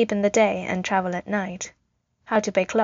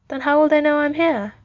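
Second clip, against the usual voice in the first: put-on small child's higher-pitched question; little boy; 7 semitones higher, 4 dB louder than usual. A narrator's reading. Tony asks the soldier about his parents, childlike and high. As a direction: ask it as a young boy, voice lifted.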